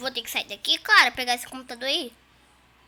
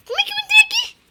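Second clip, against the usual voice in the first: surprised; 11 dB louder, faster than usual. Real, not acted. Confused in game.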